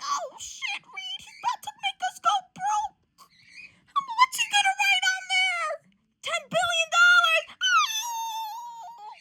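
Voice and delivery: very high-pitched squealing alarm; hammed-up squeal building to a yell; excited mock panic. Teammate fearing they will go broke, squealing mock panic. Acted panic over the check.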